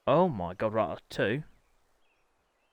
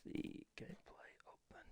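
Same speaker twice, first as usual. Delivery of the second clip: whisper; 23 dB quieter than usual. Real, not acted. Muttering setup to himself.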